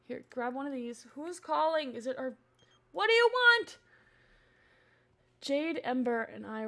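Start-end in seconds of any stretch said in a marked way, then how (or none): 2.9-3.8 high, loud, fed-up demand; exasperated shout. Real, not acted. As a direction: snap it loudly at whoever is calling. Exasperated at interrupting caller in game.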